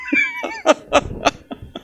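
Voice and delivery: a quick high chuckle; short high laugh. Retold or imitated. Brother who skipped church laughs off his break. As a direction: laugh it off lightly. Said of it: "medio riendo".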